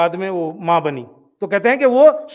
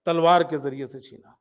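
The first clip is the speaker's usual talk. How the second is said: softer, lower echo of the phrase; quiet repeat; 7 semitones lower, 6 dB quieter than usual. Retold or imitated. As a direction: let تلوار کے ذریعے سے چھینا sink in quietly. (دھیمی آواز میں دہرانا)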